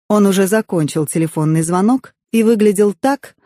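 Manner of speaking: usual speaking voice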